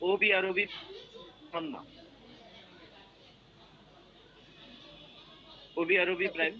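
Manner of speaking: choppy broken-up audio; words cutting in and out; breaking up. Real, not acted. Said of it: "আপনার কথাগুলো কেটে কেটে".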